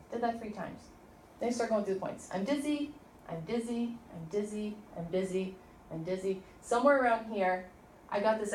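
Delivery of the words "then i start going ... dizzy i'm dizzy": ordinary delivery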